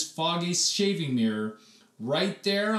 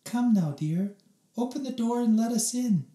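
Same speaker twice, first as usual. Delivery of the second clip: calm mom voice; 9 semitones higher than usual. Acted. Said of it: "calm mom voice".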